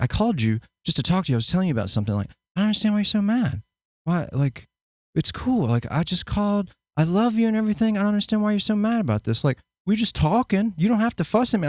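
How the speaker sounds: patient reassuring self. Retold or imitated. Calmly answering mother's fussing.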